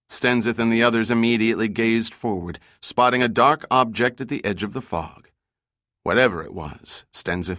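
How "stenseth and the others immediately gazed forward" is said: ordinary delivery, nothing marked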